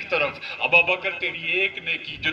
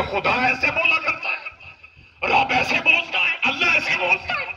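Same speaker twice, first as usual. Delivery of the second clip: mock incredulous question; 9 semitones higher than usual. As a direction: ask it as if the idea were absurd. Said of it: "خدا ایسے بولا نہیں کرتے".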